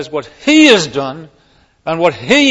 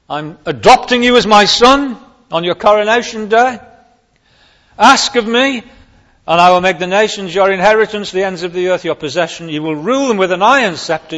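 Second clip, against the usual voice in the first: higher, louder proclamation; proclaiming decree; 5 semitones higher, 4 dB louder than usual. Acted. God speaking to the anointed king, proclaiming. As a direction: announce it like a royal decree.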